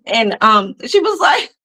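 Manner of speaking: usual speaking voice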